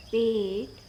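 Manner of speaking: usual speaking voice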